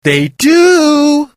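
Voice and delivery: loud, high, insistent They do; stressed insistence. A narrator's reading. Val insisting sea monsters exist, loud emphatic. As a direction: lean hard on do. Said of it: "Val stressed".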